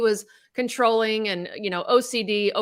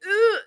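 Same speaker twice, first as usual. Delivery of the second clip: high mock groan of dread; imitated kid groan; 12 semitones higher, 3 dB louder than usual. Mother imitating her tense kids, high mock groan. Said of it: "my kids would be like".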